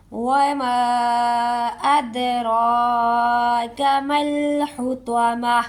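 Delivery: very slow melodic tilawat; Quran recitation. Shown as a demonstration. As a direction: stretch each madd. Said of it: "recitation demo". (آہستہ سُریلی تلاوت)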